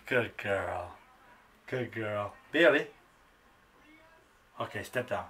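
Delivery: low, hushed coaxing praise; soft praise. Real, not acted. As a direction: murmur it gently to the bird. Soft praise while training bird.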